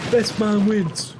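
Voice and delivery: loud, high, drawn-out Cockney cry; obnoxious Cockney Brit. Acted. Game soldier calling out in Warzone, obnoxious Cockney Brit. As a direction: bellow it slowly in mock Cockney. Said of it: "the obnoxious Cockney Brits".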